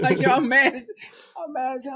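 hammed-up outrage cracking into laughter; mock mad, laughing. Recalling the cops at the door, laughing mock outrage. Retelling his anger, laughing.

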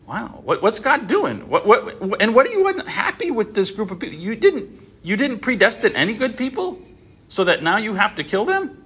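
high-pitched, baffled questioning; mock incredulity. Teacher challenging God over predestining no good people, mock incredulous. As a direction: protest in exaggerated disbelief, pitch climbing.

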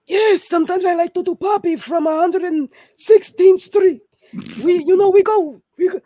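put-on loud high old man voice; Old Man Poppy. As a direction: ham up a loud old-timer. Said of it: "Trying to do Old Man Poppy".

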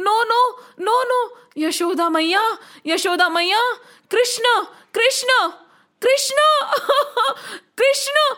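high, sobbing stammer of a name; mock-cry in childish panic; crying children. A narrator's reading. Children cry to Yashoda about Krishna, crying. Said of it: "Why are you crying?".

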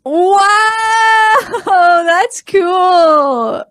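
high, drawn-out wow of delight; amazed. Real, not acted. Friend watching the bottle finally open, amazed high cheer.

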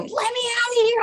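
mock panicked high wail; panicked plea. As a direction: beg to be let out. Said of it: "the glass going".

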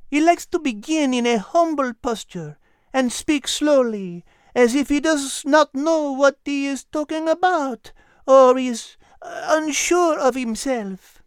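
high, small dog voice with a French touch; a tiny French dog explaining; high chatty dog voice; little French dog. A narrator's reading. Liz explains.

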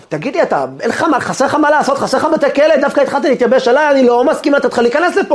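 high-pitched irritated complaint; getting annoyed. Retold or imitated. Rabbi refusing the persistent visitor, getting annoyed. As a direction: scold him for pestering, voice climbing. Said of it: "התחיל להתעצבן עליו".

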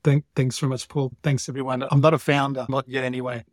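ordinary talk, nothing marked

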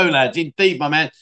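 ordinary talk, nothing marked

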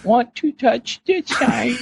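high mock quote voice; mock quoted voice. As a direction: squeak the quote out like a cartoon. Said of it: "you quoted".

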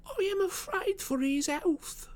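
slow, very high voice, mock-hushed; confiding landlady. Landlady confides worry about her lodger, slow falsetto. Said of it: "sinking her voice into an impressive whisper".

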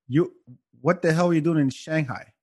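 ordinary talk

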